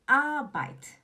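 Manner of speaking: ARBEIT with a loud long "AH", falling after; "AAH-bait", high then down; stress on A. Shown as a demonstration. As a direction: punch the A of Arbeit. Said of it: "O tom tá no A".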